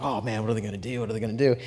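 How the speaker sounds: hushed, eager wondering to himself; a quiet, giddy mutter of anticipation; hushed excited guessing on the way to camp. Retold or imitated. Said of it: "I'm all excited".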